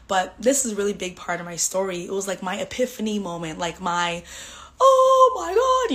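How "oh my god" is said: high, slow, drawn-out "oh my God" in loud wonder; awed gasp. Retold or imitated. Retelling her epiphany, a loud, high, awestruck gasp. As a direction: let the revelation hit you.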